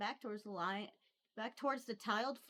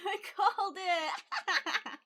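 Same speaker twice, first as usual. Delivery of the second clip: loud, high, drawn-out crow of triumph; real laughing triumph; gleeful crowing; 9 semitones higher, 8 dB louder, much slower than usual. Real, not acted. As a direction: crow it out, delighted to be right. Gloats that the prediction came true.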